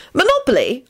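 high, questioning woman's guess; querying. A narrator's reading. Elizabeth guesses which game Lupin means, querying. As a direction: ask it as a guess. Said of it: "Elizabeth queried".

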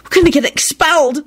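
high, panicked whine; desperate wail. A narrator's reading. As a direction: wail in despair. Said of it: "Esther wailed desperately".

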